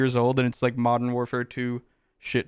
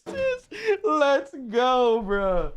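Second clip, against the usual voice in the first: much higher squealed cheer; excited squeal; 10 semitones higher, much slower than usual. Real, not acted. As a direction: squeal with delight. Song request comes up.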